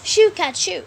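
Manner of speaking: shooing the cat. Acted. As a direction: shoo the cat away, loud and high. Peter chasing off the cat.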